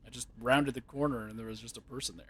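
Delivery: ordinary talk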